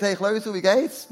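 high, loud cheery hail; mock calling out. Bystander hails the preacher mid-fall, high mock call. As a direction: call out casually to someone falling past. Acts passer-by calling to falling man.

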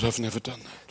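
hushed admission. Retold or imitated. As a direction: confess it under the breath. Quiet admission during prayer. (a quiet realisation during his prayer)

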